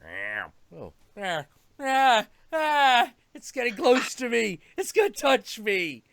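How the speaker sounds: mock scared cat. Other cat shrinking from Gomez, mock scared. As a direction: flinch away in mock fear. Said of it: "The other cat's like".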